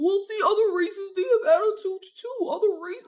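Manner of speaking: high-pitched mocking imitation of a protester; mocking objectors. Commentator imitates people saying other races do it too, mocking. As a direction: put on a high voice to parody objectors.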